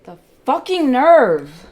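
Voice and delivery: loud, slow, seething fury at the chart; furious outburst. Real, not acted. Trader raging at the market, slow furious shout. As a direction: spit it out in real rage.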